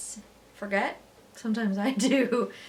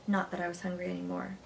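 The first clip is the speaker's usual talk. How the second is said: ordinary delivery, nothing marked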